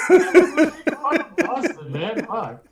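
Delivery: high, loud words squeezed through laughing; laughing too hard to talk; helpless laughter. Real, not acted. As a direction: curse while cracking up. Cracking up after mocking Boston.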